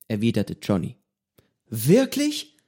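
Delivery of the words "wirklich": high astonished cry; the boy can't believe it; amazed cry. A narrator's reading. Pedro amazed that Johnny is a pirate, high surprised cry. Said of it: "rief Pedro und riss die Augen weit auf".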